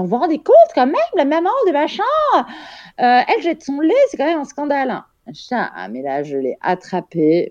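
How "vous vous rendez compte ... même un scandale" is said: high, scandalised imitation; higher-pitched gossiping nurse, scandalised; mock gossiping nurse. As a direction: gossip to colleagues with fake outrage. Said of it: "en disant".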